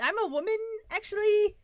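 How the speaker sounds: sudden high, loud indignant correction. Pretend. Mock outrage correcting a friend about being a woman. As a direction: correct them with mock outrage, pitch jumping up.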